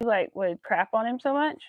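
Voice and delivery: normal talking voice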